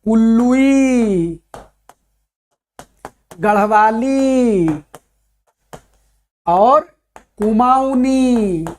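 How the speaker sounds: each dialect name drawn out slowly with long pauses; slow dictation. Acted. Teacher slowly dictating Hindi dialect groups to students. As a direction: spell it out slowly so students can write it down.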